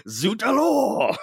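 high, slow, hammed-up French accent; exaggerated French accent, drawn out; mock French accent. Mocking the missing Batroc French accent. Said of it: "the Batroc accent".

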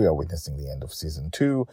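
usual speaking voice

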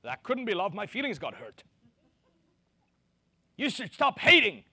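mock a wounded complainer; hurt feelings whine. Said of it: "if their feelings are hurt".

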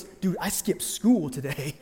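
lightly voiced teen, low and slow; a hint of a sheepish kid, dragging it out; glum teenager confessing.

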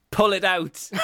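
high mock-angry shout; Aggressive Piglet. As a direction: yell the punchline as a comic order. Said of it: "So Piglet says".

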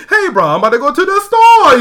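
"store" lifted high and loud at the end; imitating the island lilt that ends high. Shown as a demonstration. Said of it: "they go real high at the end".

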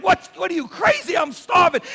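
high, loud disbelieving shout; starving brother shouting in disbelief; shocked shout. Acted. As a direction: yell it at your crazy little brother. Hungry brother shouting at David.

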